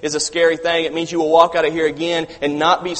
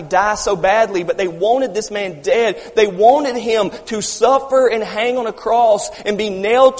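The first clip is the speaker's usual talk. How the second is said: louder and higher, driving the point home; preaching at full force; 5 semitones higher, 3 dB louder than usual. Real, not acted. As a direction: build it up like a preacher at the pulpit.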